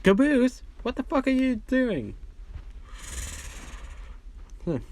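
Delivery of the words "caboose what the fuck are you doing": mock yell. Tucker yelling at Caboose.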